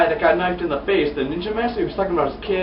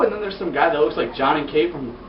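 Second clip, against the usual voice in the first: usual speaking voice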